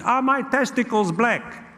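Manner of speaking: put-on high, insistent Italian-accented uncle; Gino. Uncle pressing his puzzled nephew, high Italian accent. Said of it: "He said".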